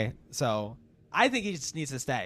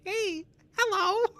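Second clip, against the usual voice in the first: high squeaky greeting; anime girl voice; slower than usual. Retold or imitated. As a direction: mimic the cutesy anime girl. Imitating the anime voice.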